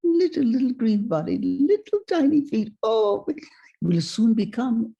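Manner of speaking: put-on high, delighted French-accented cooing; imitated rapture in a French accent; ecstatic. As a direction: marvel at a tiny creature as an old Frenchman. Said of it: "fell to the ground in ecstasy".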